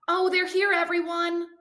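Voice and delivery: high, loud call to the whole room; excited announcement. Acted. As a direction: announce the arrival brightly to everyone. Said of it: "Vanessa announced".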